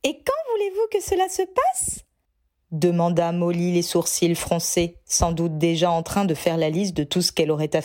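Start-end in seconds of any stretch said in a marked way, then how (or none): 0.0-2.0 high, motherly questioning voice; a fussy mother asking when; Molly's voice. Acted. Mother asks the couple about the date, high fussy voice.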